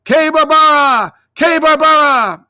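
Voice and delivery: high, loud call for the lost lamb; boy shouting for his sheep. Acted. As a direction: call her name across the pasture. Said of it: "he stopped and he called".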